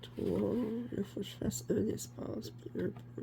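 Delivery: speaking while holding breath. Real, not acted. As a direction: squeeze the words out on held breath. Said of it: "Je retiens mon souffle".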